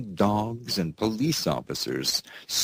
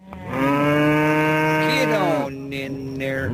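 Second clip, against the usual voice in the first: slow, high cowboy drawl; drawled cowboy call; 6 semitones higher, 4 dB louder, much slower than usual. Acted. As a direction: herd them in like cattle. Host herding stories into corral.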